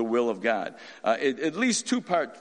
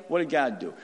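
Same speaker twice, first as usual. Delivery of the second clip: ordinary delivery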